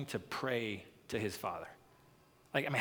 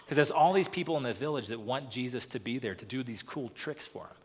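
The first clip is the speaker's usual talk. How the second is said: usual speaking voice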